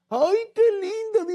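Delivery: squealing, very high and slow, like a delighted wife; wife's voice. Acted. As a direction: esposa encantada, voz muy aguda y lenta. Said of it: "dice ella". (voz aguda y lenta de esposa encantada)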